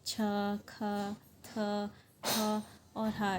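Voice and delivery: छ, ख, थ, थ, हा spaced out, each with an H puff; aspirated consonants. Shown as a demonstration. Teacher giving examples of aspirated sounds, छ, ख, थ, थ, हा each breathed out with H. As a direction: slowly list छ, ख, थ, थ, हा with audible aspiration. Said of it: "इन सब में हा की साउंड आ रही है".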